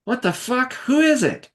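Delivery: high-pitched, irritated call; irritated character voice. Acted. Anthony demands who is at the door, high irritated voice. Acted Anthony calling back from inside.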